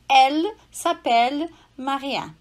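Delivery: Elle s'appelle Maria said slowly with open E; slow French sentence. Shown as a demonstration. Teacher to student: slow French Elle s'appelle Maria. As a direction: model the French sentence clearly. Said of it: "eu vou dizer".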